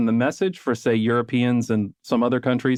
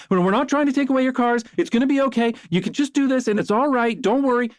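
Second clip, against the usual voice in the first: much higher, syrupy mock-soothing voice, mocking YouTubers; mock reassuring hedger; 9 semitones higher than usual. Urbanist mocks hedging YouTubers who soothe drivers, in high mock-soothing voice. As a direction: coo it like a condescending babysitter, much higher.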